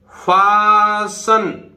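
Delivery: फासन stretched very slowly, no t; silent t. Shown as a demonstration. Teacher modelling for students: slow फासन without t. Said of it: "एसटीईएन".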